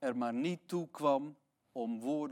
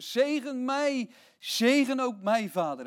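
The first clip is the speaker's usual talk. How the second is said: higher, slow pleading, half acted; retold desperate plea; 8 semitones higher, 4 dB louder than usual. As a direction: echo Esau's cry once more. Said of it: "En hij barst in tranen uit".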